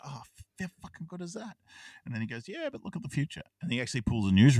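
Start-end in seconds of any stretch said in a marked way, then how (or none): 0.0-1.6 softer and higher, a breathy hushed marvel; retold whisper of amazement; awed whisper. Blake marvels at his tower. 2.5-3.4 much higher, a teasing put-on voice; lightly voiced hologram guide; mock high voice. Al's reply voiced high.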